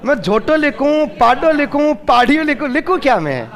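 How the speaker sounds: a hint of a puzzled clerk asking what to write; mock confused official. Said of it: "अब संविधान वाले कहे कि मैं लिखूं क्या". (हल्के से उलझे हुए अफ़सर की नकल, ऊँची आवाज़ में)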